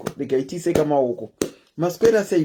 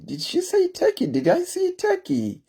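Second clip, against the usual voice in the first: high, squeaky disbelieving question; surprised double take; 13 semitones higher, 3 dB quieter than usual. Real, not acted. Host rereading a viewer's comment, squeaky surprise. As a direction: pitch jumps way up in mock disbelief.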